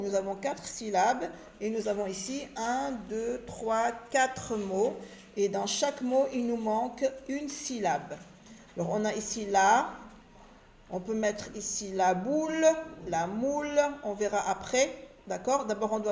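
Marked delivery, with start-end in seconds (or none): none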